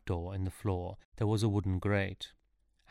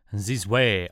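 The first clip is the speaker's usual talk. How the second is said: louder, curt command; order; 7 dB louder than usual. A narrator's reading. Napoleon ordering the procession onward, loud command. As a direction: bark the order to march. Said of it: "ordered Napoleon".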